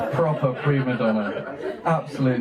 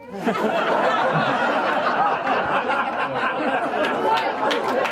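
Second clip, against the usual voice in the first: long unvoiced laugh; 13 semitones higher than usual. Real, not acted. Laughing at brother's childhood joke.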